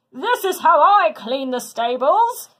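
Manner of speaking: booming hero yelling over a flood; shouting over noise. A narrator's reading. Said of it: "Hercules shouted over the noise".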